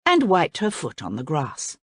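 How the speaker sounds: plain reading voice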